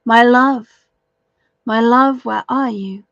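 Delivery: searching for a lost lover; calling out. Acted. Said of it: "There she called out".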